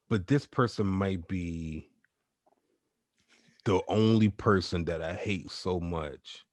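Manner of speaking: slow, weighty statement with long pauses; deliberate confession. Real, not acted. Confessing hatred to the co-hosts, slow and deliberate. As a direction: draw it out gravely, word by word. Solemn confession of hate on podcast.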